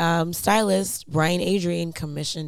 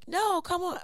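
high, urging coax; high pleading voice; 12 semitones higher than usual. Retold or imitated. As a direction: pull them along, pitched high. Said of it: "was like".

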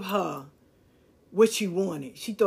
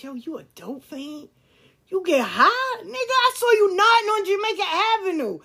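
high, loud, heated accusation; retold furious confrontation, pitched high and loud; accusing shout; 11 semitones higher, 6 dB louder than usual. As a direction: call him out hard, voice shooting up. Confronting the father about dope.

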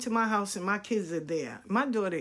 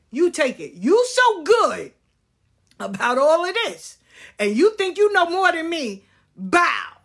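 mock-praising him at raised volume; retold telling-off; 6 semitones higher, 6 dB louder than usual. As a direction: throw it back at him, louder and higher. Telling off son while leaving.

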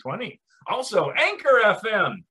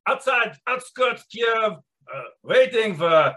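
bad Russian accent. Podcast host opening the show in a bad Russian accent. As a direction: play a broken-English Russian podcast host. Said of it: "hold down just a bad, uh, Russian accent".

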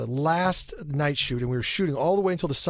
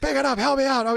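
higher, pleading push; pleading urge; 11 semitones higher, 3 dB louder than usual. Retold or imitated. Handcuffed actor pleading with co-star, high urgent plea. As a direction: beg your partner to play along. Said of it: "And I was like".

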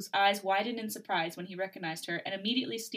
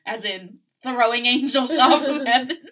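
incredulous laugh; 4 semitones higher, 12 dB louder than usual. Real, not acted. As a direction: laugh through the question. Joking disbelief, laughing.

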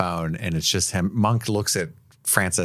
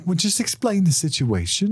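high, smooth and reasonable; Leonard impression; 10 semitones higher than usual. Host voicing Leonard advising Francis, high and smooth. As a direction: feign helpful calm while steering him.

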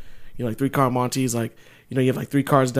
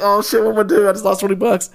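mock panic; 6 semitones higher, faster than usual. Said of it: "And I was like".

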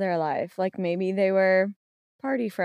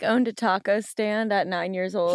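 normal talking voice

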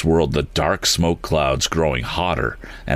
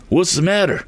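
called out; 12 semitones higher than usual. A narrator's reading. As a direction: call across the cabin. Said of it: "called Mister Damon from the cabin floor".

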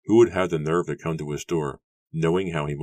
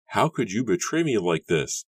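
accusing, higher-pitched outburst; angry, spat out; 6 semitones higher than usual. A narrator's reading. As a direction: spit the question out angrily. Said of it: "spitting the words angrily".

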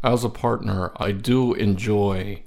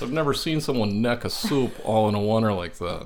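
ordinary delivery

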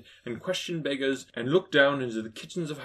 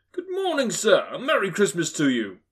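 high, loud cheery hail; good-humoured greeting; 6 semitones higher, 6 dB louder than usual. A narrator's reading. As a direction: call out a hearty holiday greeting. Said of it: "three or four good-humoured fellows said".

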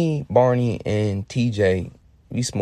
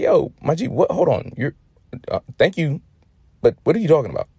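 high, louder acted bafflement; baffled; 6 semitones higher, 4 dB louder than usual. Storyteller questioning the friend who co-signed, acted bafflement. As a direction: stop him short, puzzled and pitched up. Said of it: "in the oddest way".

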